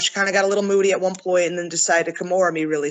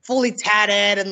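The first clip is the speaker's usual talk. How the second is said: ordinary talk, nothing marked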